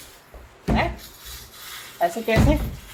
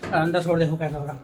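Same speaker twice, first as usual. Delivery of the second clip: ordinary talk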